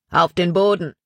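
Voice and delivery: curt order from a rough bandit, lightly voiced; rough commanding voice. A narrator's reading. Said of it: "befahl eine raue Stimme".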